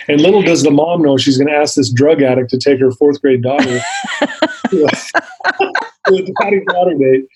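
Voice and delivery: amused, laughter breaking up the sentence; laughing while talking. Real, not acted. Recovering addict recalling the daddy-daughter date, laughing. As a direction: tell it through your laughter.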